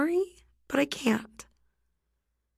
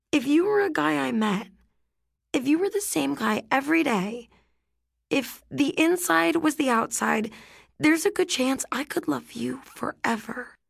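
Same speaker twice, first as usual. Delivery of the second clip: put-on higher girl's voice, sadly letting him down; higher voice of a teen girl saying no with care; Rhiannon, gentle but firm; 6 semitones higher than usual. A narrator's reading. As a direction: break it to him kindly but decide.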